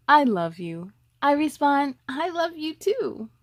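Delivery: usual speaking voice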